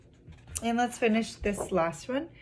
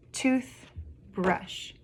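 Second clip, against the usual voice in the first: "tooth", clear gap, "brush"; syllable by syllable; 3 semitones higher than usual. Shown as a demonstration. Teacher showing children two syllables, broken apart. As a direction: break "toothbrush" into two parts. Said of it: "two syllables".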